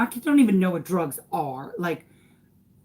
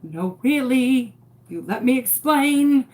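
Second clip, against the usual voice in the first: desperate insistence; 6 semitones higher, 6 dB louder than usual. Retold or imitated. Mother insisting police let her explain, desperate. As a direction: insist forcefully with pitch lifted.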